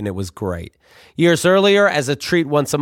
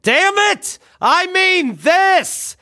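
much higher, very slow furious mock cry; outraged dad; much slower than usual. Father exploding at his son over the folder, high and hammed-up. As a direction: blow up like a hammy father.

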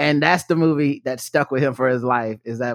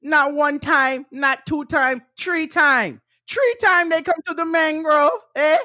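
high, imitated Jamaican accent; playful Jamaican accent impression, high-pitched; 12 semitones higher, slower than usual. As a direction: mimic an emphatic Jamaican speaker, pitched up. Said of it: "Jamaican accents, the best".

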